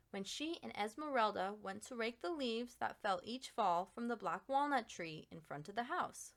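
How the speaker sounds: ordinary delivery, nothing marked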